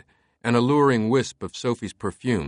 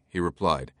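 ordinary delivery, nothing marked

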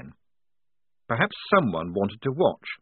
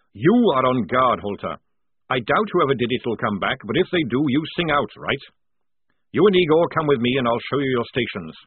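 louder, higher barking sergeant; gruff sergeant; 3 semitones higher, 6 dB louder than usual. A narrator's reading. Jackram calling the squad to post sentries, loud. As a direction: order the lads about like a drill sergeant. Jackram assigning guard duty.

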